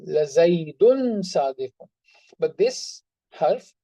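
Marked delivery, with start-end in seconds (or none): 0.1-1.9 slow stress swelling on "Zaidun"; weighty, drawn-out "Zaidun"; emphatic. Shown as a demonstration. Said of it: "that is the meaning of this lam".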